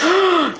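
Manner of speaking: high, sharp gasp of amazement; awed gasp. Real, not acted. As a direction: gasp at thrilling news. Hears he met the President.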